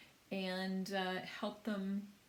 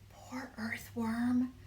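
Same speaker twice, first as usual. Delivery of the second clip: slow whisper in an ear; 3 semitones higher, much slower than usual. A narrator's reading. Ladybug whispering to James about the earthworm. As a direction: breathe it into his ear. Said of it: "whispering in James's ear".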